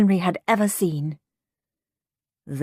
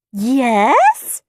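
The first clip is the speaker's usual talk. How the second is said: loud, raised, insistent voice; firm nurse; 6 semitones higher, 7 dB louder than usual. A narrator's reading. Nurse Needle overrules Henry, firm and loud. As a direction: overrule the child firmly.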